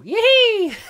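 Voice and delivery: a high, loud, gleeful yelp; excited squeal. Real, not acted. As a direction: squeal with delight at getting this far. Gleeful cheer at surviving.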